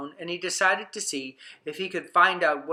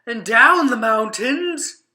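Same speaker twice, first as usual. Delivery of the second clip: down the mountains higher, louder and slow; rising on down; 6 semitones higher, 8 dB louder, slower than usual. A narrator's reading. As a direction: swoop playfully on down. Contrast up and down.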